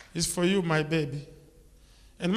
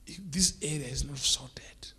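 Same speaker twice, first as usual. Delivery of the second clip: 1 hushed aside; 4 semitones lower, 8 dB quieter than usual. Real, not acted. Dramatic lowered aside in sermon.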